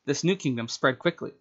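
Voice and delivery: ordinary delivery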